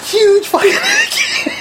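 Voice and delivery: high squeal of laughter through slow words; real laughter. Real, not acted. Friend telling of a huge hickey, cracking up. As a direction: choke it out while cracking up.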